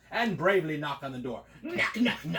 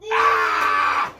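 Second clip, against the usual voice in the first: loud, much lower scream of fright; terrified scream; 11 semitones lower, 12 dB louder than usual. Acted. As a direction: shriek at the dragon behind you. Prince sees the dragon.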